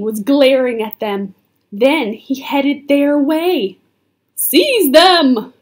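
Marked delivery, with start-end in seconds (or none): 4.4-5.5 loud, raised royal order; shouted command. A narrator's reading. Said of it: "the Dragon King shouted".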